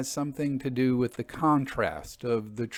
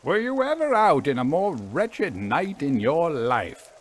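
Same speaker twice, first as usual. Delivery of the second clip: disgusted; 5 semitones higher, 4 dB louder than usual. A narrator's reading. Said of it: "asked Harry Luscombe in a tone of disgust".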